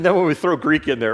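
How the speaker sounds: usual speaking voice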